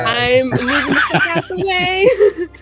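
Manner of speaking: loud, high laughing yell; laughing shout. Real, not acted. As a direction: yell it while cracking up.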